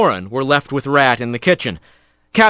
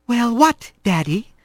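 put-on higher, sulky girl voice; defiant daughter; 8 semitones higher than usual. A narrator's reading. Daughter answering her father, higher and sulky. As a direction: play innocent to her father. Corin voice.

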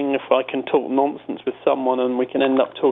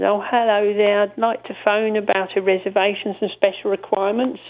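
Robin Cooper voice; 7 semitones higher than usual. Retold or imitated. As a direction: sound genteel and overly polite. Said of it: "he had a certain voice".

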